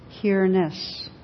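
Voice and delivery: ordinary talk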